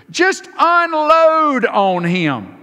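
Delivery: urgent plea. Real, not acted. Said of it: "Just tell Him how you feel".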